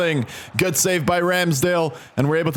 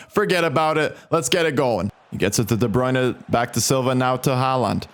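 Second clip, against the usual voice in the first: ordinary talk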